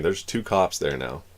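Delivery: ordinary delivery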